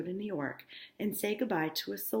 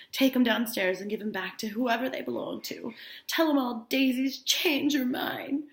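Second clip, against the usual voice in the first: put-on drunk Daisy; 7 semitones higher, 8 dB louder than usual. Drunk Daisy telling Jordan to return the pearls, slurring. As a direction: slur it like a drunk bride giving up. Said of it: "talking like that 'cause she's drunk".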